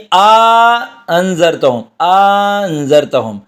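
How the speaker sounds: "آ انذرتہم" slowly, long aa before the second hamza; "آ انذرتہم" with a stretched opening vowel between the hamzas; alif inserted between hamzas. Shown as a demonstration. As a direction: hold "آ", then move into "انذرتہم". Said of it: "الف کو داخل کر کے پڑھ دیا جائے".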